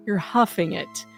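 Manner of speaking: normal talking voice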